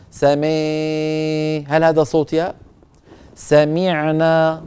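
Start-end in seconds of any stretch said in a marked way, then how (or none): 3.4-4.6 سمعنا with the kasra stretched very slowly until it turns into a ya sound. Shown as a demonstration. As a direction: pull the mi of سمعنا out into a long ee. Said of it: "مطها". (مدّ كسرة سمعنا حتى تصير ياء)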